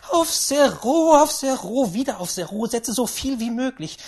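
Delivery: loud, feverish orders; insistent great-aunt. Acted. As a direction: insist excitedly, over and over. Great-aunt insists on betting zero.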